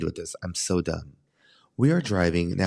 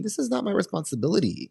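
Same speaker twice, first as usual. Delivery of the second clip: indignant; 12 semitones higher than usual. Real, not acted. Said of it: "reading the rules".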